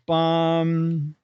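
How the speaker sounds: ordinary talk, nothing marked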